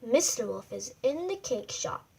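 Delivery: ordinary delivery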